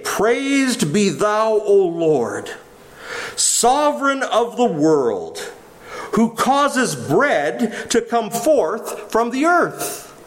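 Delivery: slow, measured prayer intonation, each phrase drawn out; solemn Passover blessing. Acted. Head of household blessing bread at Passover, slow solemn prayer. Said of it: "here's the typical blessing".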